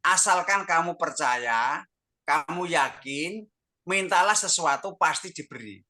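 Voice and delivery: slow, drawn-out exhortation; emphatic exhortation. Retold or imitated. Pastor encouraging a believer to trust, slow emphatic exhortation. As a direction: stretch each phrase like a preacher's promise.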